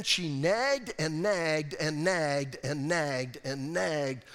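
slow, drawn-out repetition of nagged; slow, weary emphasis; dragged out. Acted. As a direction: stretch each nagged out.

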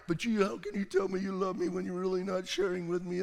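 mock her nagging plea; hammed-up clingy wife; whiny Delilah. Delilah whining paraphrase.

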